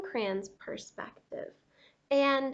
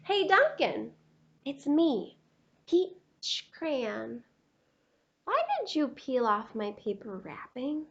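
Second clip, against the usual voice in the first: a lightly voiced, mortified crayon; embarrassed peach crayon; 5 semitones higher, 7 dB louder than usual. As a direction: read it like a crayon too shy to come out.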